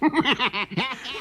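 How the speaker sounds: high, soft chuckle; gleeful fox laugh. A narrator's reading. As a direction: laugh under the breath.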